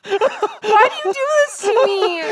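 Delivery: loud, high, drawn-out wail; real tearful laugh-cry. Real, not acted. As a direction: wail in protest, half laughing through tears. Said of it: "You're actually crying?".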